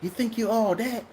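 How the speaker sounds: a lightly mocking pastor's jab; imitating the pastor. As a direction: tease him about his sports car from the pulpit. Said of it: "He said".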